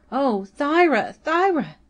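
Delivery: high, breathless cry of her name; gasping. A narrator's reading. Cynthia gasping to Thyra with news. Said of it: "gasped Cynthia".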